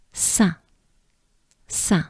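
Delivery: "Saint" with n and t silent; French nasal vowel. Shown as a demonstration. Teacher pronouncing it together with learners, French nasal vowel. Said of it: "we can pronounce it together".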